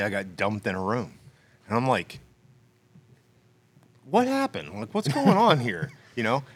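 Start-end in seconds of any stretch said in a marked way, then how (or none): 4.0-5.9 confused high question. Retold or imitated. Confused in empty room.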